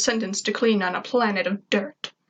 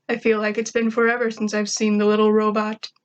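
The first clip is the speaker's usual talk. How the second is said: usual speaking voice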